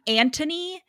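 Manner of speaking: Anthony said as "AN-tuh-nee"; hard T in Anthony, middle vowel reduced; Anthony with T in place of TH. Shown as a demonstration. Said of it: "sounds weird to me in American accent".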